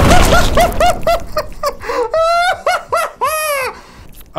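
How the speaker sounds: long high cackle; loud, helpless high laughter; hysterical laughing. Real, not acted. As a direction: break down laughing mid-protest. Laughing mid protest.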